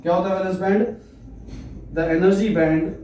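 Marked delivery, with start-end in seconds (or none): 1.9-2.9 word-by-word dictation with long pauses; slow dictation. Acted. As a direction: dictate slowly for note-takers, repeating phrases. Dictates definition for note-taking. (धीरे-धीरे, रुक-रुक कर श्रुतलेख)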